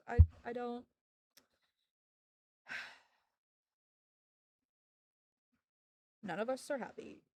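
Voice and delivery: ordinary delivery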